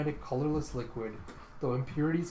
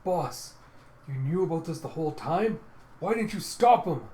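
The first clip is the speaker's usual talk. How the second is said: higher, building to a loud reproach; accusing; 4 semitones higher, 5 dB louder than usual. Retold or imitated. Mourner confronts boss who knew about poison, accusing. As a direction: start pleading, then cry out the accusation.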